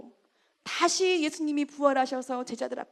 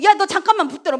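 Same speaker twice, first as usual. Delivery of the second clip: calling her back; 4 semitones higher, 9 dB louder, much faster than usual. Retold or imitated. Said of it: "막 돌아서가지고".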